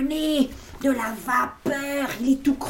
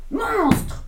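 sharp high call of a cross mother; mother scolding; 12 semitones higher, 3 dB louder than usual. Acted. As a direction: call him out like a fed-up mum. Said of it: "lui dit sa maman". (maman qui gronde, voix aiguë)

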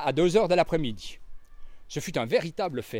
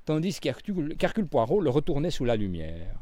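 usual speaking voice

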